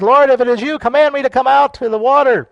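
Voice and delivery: high, loud plea; calling out to Jesus. Acted. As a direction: call boldly across the water. Acting Peter calling to Jesus.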